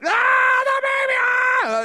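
loud, very high mock wail; hammed-up birthing scream; imitated childbirth scream. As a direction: mimic a group screaming through fake labor. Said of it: "and she's screaming".

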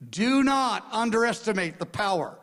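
ordinary talk, nothing marked

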